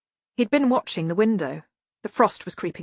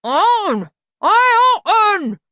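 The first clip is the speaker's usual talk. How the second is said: high, loud squawk through a full beak; muffled; 14 semitones higher, 10 dB louder, much slower than usual. A narrator's reading. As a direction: shout with a beak full of egg. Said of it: "a muffled voice say".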